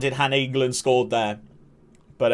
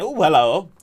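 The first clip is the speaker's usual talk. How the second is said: a light, higher imitation of James saying hello; mock greeting; 4 semitones higher, 5 dB louder than usual. Streamer imitating James greeting someone, playful. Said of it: "James saying".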